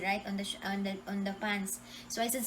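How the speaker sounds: ordinary delivery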